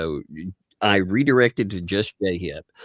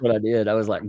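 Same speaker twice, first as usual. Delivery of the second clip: usual speaking voice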